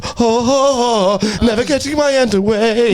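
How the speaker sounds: hammed-up moaning oh oh; mock moaning. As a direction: ham up a pleasure moan.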